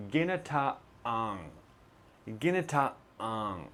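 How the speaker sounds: "ginataang" slow, with a clear throat stop in the middle; gi-na-ta-ang, cut sharply in the middle; glottal stop in ginataang. Shown as a demonstration. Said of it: "try to say this with me".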